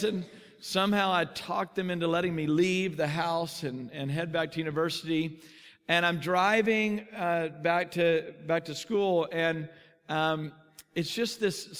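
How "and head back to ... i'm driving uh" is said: ordinary talk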